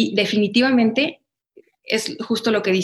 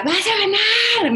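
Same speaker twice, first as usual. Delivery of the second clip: much higher, slow, called-out cheer; slow high mock yell of encouragement; retold shout; 12 semitones higher, much slower than usual. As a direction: shout it like a proud dad from the background. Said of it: "Me gritaba".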